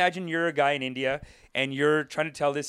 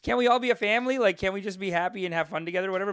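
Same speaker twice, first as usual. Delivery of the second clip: put-on pleading child; 4 semitones higher than usual. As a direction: beg like a kid whose parents split up. Child pleading parents not divorce.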